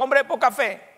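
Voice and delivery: firm correcting rebuke. Shown as a demonstration. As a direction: set the record straight with a firm reproach. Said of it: "No, ¿qué le dijo?".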